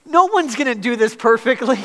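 high, loud sermon delivery; impassioned preaching. Real, not acted. Preaches at raised force.

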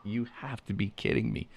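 soft, high amazed disbelief. Retold or imitated. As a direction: say it in hushed amazement. Dog brings out the duck.

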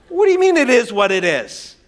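lightly voiced affronted comeback; challenging wife. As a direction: imitate a wife querying a brush-off. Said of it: "if she comes back and says".

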